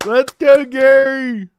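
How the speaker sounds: root loudly for your fighter; cheering. Real, not acted. Fan rooting for Gary, spirited cheer.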